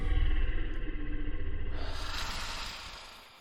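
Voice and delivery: weary sigh. Acted. Dragon sighs at host.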